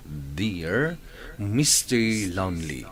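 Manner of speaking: ordinary talk, nothing marked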